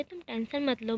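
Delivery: ordinary delivery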